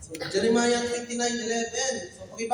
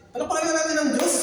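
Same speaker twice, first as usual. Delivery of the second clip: higher, rising call to clap for God; rousing call; 8 semitones higher, 3 dB louder than usual. Real, not acted. Preacher rousing the congregation to applaud, high and lifted. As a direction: cheer the room into applause.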